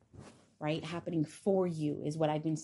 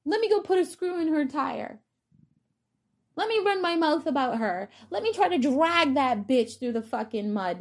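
high, loud shrieking hater voice, much higher than her own; mocking a petty hater; 11 semitones higher, 8 dB louder than usual. Mocking the triggered woman who wants to curse her, in a high shrill rant. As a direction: shriek it like a spiteful gossip plotting revenge. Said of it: "Instead of being".

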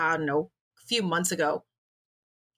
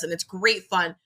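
ordinary talk

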